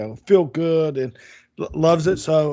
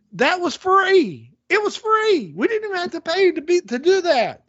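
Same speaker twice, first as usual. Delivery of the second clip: emphatic table-banger; 11 semitones higher than usual. Acted. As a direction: drive the point home, pitch soaring. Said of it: "bang on the table like Jeff does".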